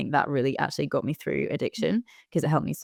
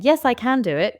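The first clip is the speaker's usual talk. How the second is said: forced positivity; 8 semitones higher, 4 dB louder than usual. Retold or imitated. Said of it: "instead of saying".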